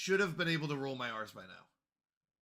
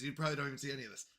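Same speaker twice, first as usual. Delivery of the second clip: ordinary talk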